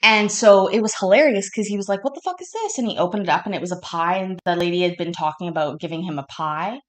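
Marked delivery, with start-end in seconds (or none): 2.0-2.8 pitched way up in mock disbelief; shocked outburst. Coworker opening the pie box, high shocked voice. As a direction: quote the guy's baffled outburst high.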